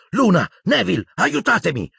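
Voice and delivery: high-pitched urgent cry; calling for help. A narrator's reading. Harry calls Luna and Neville for help, urgent. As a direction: call out urgently to your friends.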